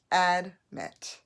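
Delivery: "admit" as a loud full AD, then mit; syllable by syllable. Shown as a demonstration. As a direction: split "admit" in two, clear short a first. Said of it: "So, but it's admit".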